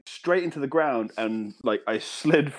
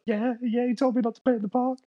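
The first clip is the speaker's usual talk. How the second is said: put-on high, timid kid voice; imitating a cowed child, high-pitched; scared child; 10 semitones higher than usual. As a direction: answer mum in a frightened little-boy squeak. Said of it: "I was so fucking scared to talk".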